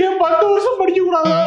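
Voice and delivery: ordinary talk, nothing marked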